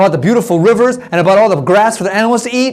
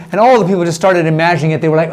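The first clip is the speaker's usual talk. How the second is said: plain reading voice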